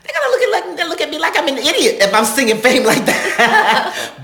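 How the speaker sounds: high, very loud objection; exasperated protest. Retold or imitated. Student objecting to singing Fame operatically, loud and comic. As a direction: wail the complaint at full volume, comically.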